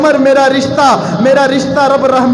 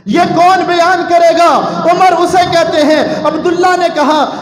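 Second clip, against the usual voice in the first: shouted, high sermon climax; preaching at full force; 7 semitones higher than usual. Acted. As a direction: thunder it out to the crowd.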